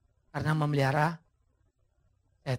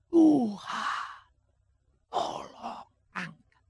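barely voiced, weak begging; faint plea; 2 semitones higher, much slower than usual. Paul's first plea to God. (permohonan lirih dan lemah)

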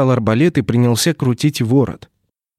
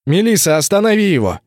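calling out; 7 semitones higher than usual. Acted. Calling out an order in battle.

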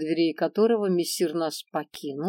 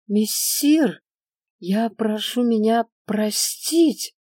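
higher, shaky pleading merchant; trembling voice; 4 semitones higher, 5 dB louder, slower than usual. A narrator's reading. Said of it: "трясущимся голосом".

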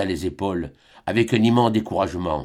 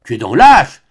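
loud scornful accusation; angry contempt; 4 semitones higher, 10 dB louder than usual. A narrator's reading. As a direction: spit out her scorn. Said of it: "reprise par la colère, les lèvres méprisantes".

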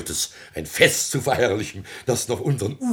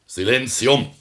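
commanding call; 6 semitones higher, 6 dB louder than usual. Acted. Mayor silences the family.

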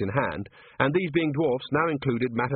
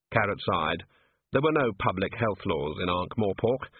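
plain reading voice

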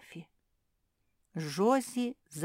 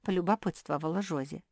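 ordinary delivery, nothing marked

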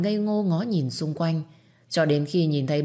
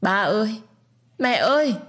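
high, loud wailing for his parents; sobbing cry; 6 semitones higher, 5 dB louder than usual. Acted. Orphaned boy wails for his parents, high and loud. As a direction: wail it like a sobbing child. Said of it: "òa lên khóc nức nở".

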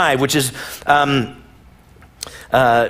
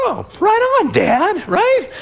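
much higher, breezy and eager; cheerful kid voice; slower than usual. Retold or imitated. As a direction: play the boy as a clueless happy teen.

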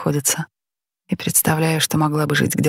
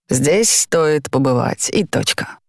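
slow, drawn-out commercial voiceover; ad announcer voice; 2 semitones lower, slower than usual. A narrator's reading. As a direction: sell it like a TV ad. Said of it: "говорит голосом комментатора из рекламного ролика". (голос диктора из рекламы)

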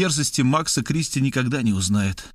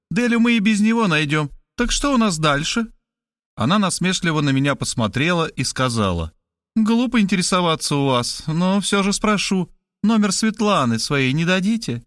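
higher, bluntly cheerful woman; higher woman's voice, tactless and chirpy; brash Markhaleva; 6 semitones higher than usual. A narrator's reading. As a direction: drop the bad news breezily.

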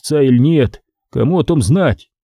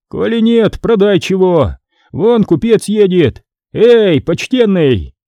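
impatient scribe; 7 semitones higher, 3 dB louder than usual. A narrator's reading. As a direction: push the debtor, then hail the merchant.

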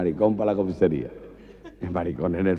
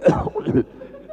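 tos fingida. Acted. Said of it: "el tuberculoso que tose".

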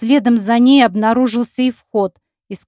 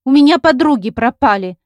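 higher, louder upset protest; upset shout; 4 semitones higher, 5 dB louder than usual. A narrator's reading. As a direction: cry it out in alarm. Gulya protests, voice raised.

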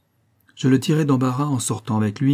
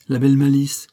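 louder, higher mock-impressed outburst; scornful exclamation; 4 semitones higher, 6 dB louder than usual. A narrator's reading. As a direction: exclaim it at raised pitch and volume. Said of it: "s'écria la comtesse".

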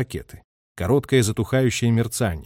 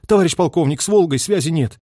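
higher-pitched urgent report; signalman's report; 5 semitones higher, 4 dB louder than usual. A narrator's reading. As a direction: call out the failure to the colonel. Radio operator reports lost contact.

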